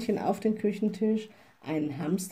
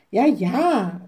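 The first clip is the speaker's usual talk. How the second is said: loud call to the door; 7 dB louder than usual. A narrator's reading. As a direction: call back loudly from inside. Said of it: "rief die kleine Hexe". (laut rufend)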